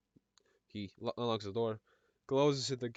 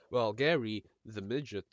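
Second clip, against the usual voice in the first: ordinary talk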